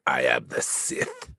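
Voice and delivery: low, very slow Sith lord; Emperor. Acted. Co-host mimicking the film villain, slow Emperor impression. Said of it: "I thought you were doing the Emperor".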